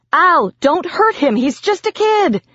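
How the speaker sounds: very high, desperate plea; shouting. A narrator's reading. Narrator begging demon not to hurt Biz, high shouted plea. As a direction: cry out to protect him. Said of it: "I shouted at Al".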